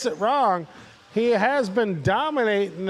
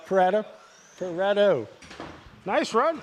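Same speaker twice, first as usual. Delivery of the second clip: usual speaking voice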